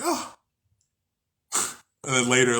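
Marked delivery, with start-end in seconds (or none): 0.0-0.4 very high squeaked oh of dismay; a tiny stunned oh, pitched way up; hammed-up shocked gasp word; mock dismayed oh.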